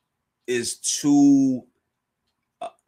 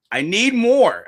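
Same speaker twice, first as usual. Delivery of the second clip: hungry Luffy; 6 semitones higher, 5 dB louder, slower than usual. Retold or imitated. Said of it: "he's talking in, uh, foodieese".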